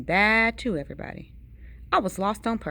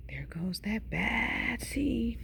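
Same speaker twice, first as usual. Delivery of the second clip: slow, hushed mumble; mumbled; 8 dB quieter, slower than usual. A narrator's reading. Onlookers mumbling about the seed behind his back, slow hushed mumble. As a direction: mutter it under your breath, drawn out. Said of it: "they mumble".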